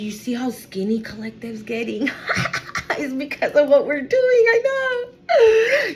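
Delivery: gleeful mean-gossip act, breaking into laughter; mocking gossip. Bullies making fun of someone who cries, mocking. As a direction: play a bully snickering about a victim. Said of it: "they would make fun of you".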